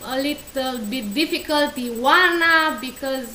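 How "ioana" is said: Ioana said ee-wah-na, louder; Romanian name. Shown as a demonstration. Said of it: "is your name pronounced Iona?".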